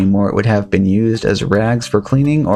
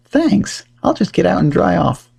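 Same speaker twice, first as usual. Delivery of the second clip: raised, quaint colonial voice; old-timey gentleman voice; 8 semitones higher than usual. Acted. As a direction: speak like a polite colonial gent. Ben thanks the boy.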